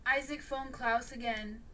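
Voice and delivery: ordinary delivery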